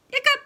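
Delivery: sharp high loud hiccup; hammed-up hiccup. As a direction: pop out a squeaky hiccup. Clifford still hiccups.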